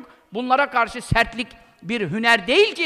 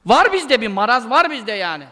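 ordinary talk

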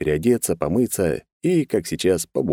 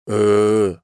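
drawn-out, louder blank hesitation; dumbly stalling; 4 semitones higher, 5 dB louder than usual. A narrator's reading. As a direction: stall with a long dim-witted hum. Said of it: "снова затупил чувак". (тупо тянуть звук)

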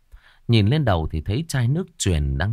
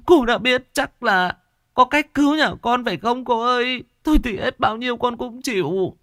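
put-on pleading old woman; 12 semitones higher than usual. Old woman begging the shaman, high whining plea. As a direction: whimper and beg. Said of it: "bà Liên khóc lóc".

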